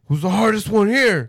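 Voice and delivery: loud, high, laughing challenge; mock tough guy yelling at the daycare. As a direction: call out the room like a bully. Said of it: "goes to the daycare and is like".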